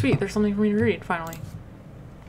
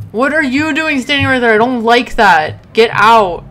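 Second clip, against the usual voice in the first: loud, startled telling-off; rattled, raised-voice protest; indignant scolding; 3 semitones higher, 11 dB louder than usual. Real, not acted. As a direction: scold the thing that spooked you. Scolds figure that startled player.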